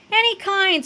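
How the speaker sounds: put-on high, urgent kid's voice; exasperated, high-pitched cry; mild scream. A narrator's reading. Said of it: "screamed Fluffy".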